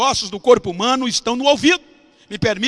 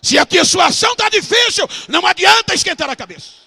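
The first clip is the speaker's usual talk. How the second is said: high, raised-voice exhortation; fervent preaching; 11 semitones higher, 4 dB louder than usual. Real, not acted. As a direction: urge the congregation, pitched high.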